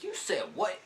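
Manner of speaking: high, loud incredulous mock outburst; imitating shocked rider. Stranger behind them reacting to the dad, mock high and loud. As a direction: demand it like you can't believe it. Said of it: "This is legitimately how he talked".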